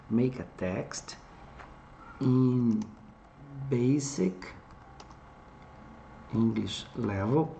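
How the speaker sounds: spelling out each English word slowly as it is typed; slow dictation of a typed prompt. Shown as a demonstration.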